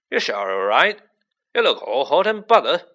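loud, swooping concern; Hagrid. A narrator's reading. Hagrid peering at Harry to check on him, loud and concerned.

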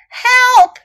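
high, loud cry for help; shouting for help. A narrator's reading. Little elephant trapped by crocodile, shouting for help. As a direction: call out sharply for rescue. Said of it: "shouted little elephant".

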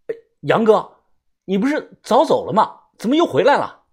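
high, loud questioning through a door; surprised calling out. A narrator's reading. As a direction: call out, puzzled at his return. Calls through door to returning guide. (隔着门高声疑问)

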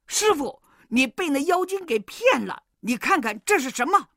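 high-pitched monkey disciple; urgent protest. A narrator's reading. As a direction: plead your case urgently, high. Wukong protests to scolding master. (孙悟空高声急切地辩解)

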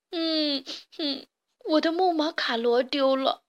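sobbing words for a lost toy; weeping. A narrator's reading. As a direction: whimper through tears. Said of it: "痛哭流涕". (伤心地抽泣着说)

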